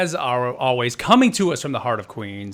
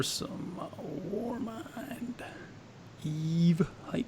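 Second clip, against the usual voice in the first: whisper; 5 semitones higher, 10 dB quieter, much slower than usual. Pretend. Hype whisper into stream.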